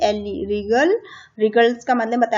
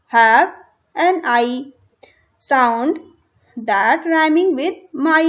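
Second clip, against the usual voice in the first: reading English instructions slowly, word by word; slow textbook reading; 4 dB louder, much slower than usual. Shown as a demonstration. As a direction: read it out deliberately for the class. (किताब से धीरे-धीरे पढ़ना)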